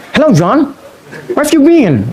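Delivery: high-pitched imitation of an English speaker; mock English accent. Preacher showing Indonesians' flexible tongues, mock English accent. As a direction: greet like a native English speaker, voice lifted high. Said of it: "udah kayak orang Inggris".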